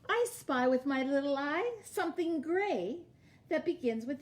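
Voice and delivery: sing-song picture-book read-aloud, each clue lifted; reading to children. Acted. Teacher reading a picture book to her class, storytime sing-song. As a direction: read it like storytime for little kids.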